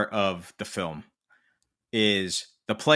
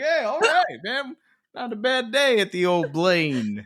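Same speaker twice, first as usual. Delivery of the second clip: high, breezy regular-guy voice; cheerful bloke; 10 semitones higher than usual. Retold or imitated. The random bloke, mistaken for Guffman, high and breezy. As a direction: stroll in chuffed with yourself. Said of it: "He's like".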